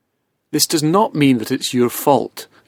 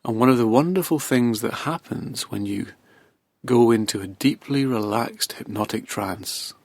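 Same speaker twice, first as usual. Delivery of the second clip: hypnotic induction; 4 dB quieter than usual. Acted. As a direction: ease the listener gently into trance, voice sinking quieter. Begins hypnotic relaxation induction.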